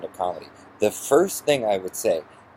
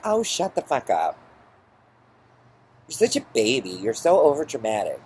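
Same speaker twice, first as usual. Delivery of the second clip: mimicking mother; 4 semitones higher than usual. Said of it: "she's like".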